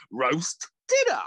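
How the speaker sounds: slow, grand naming of the dish; mock food announcement. Mimicking the TikTok eater, slow proud announcement. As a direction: announce the roast slowly. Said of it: "He's like".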